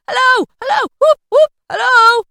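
very high, loud whooping calls; wild, whooping shouts; hallooing. A narrator's reading. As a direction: holler joyfully after someone far away. Scrooge hallooing after Friday.

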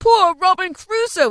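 high, loud gasping delight; breathless "oh, oh", then a delighted shout; excited cry. A narrator's reading. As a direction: cry out at a thrilling sight. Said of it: "cried Scrooge".

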